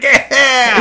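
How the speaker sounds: high, loud imitated cackle-yell; hammed-up manic whoop; mock maniacal laugh. Host mimics the star reacting to Raquel, mock maniacal outburst. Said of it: "doing this crazy maniacal".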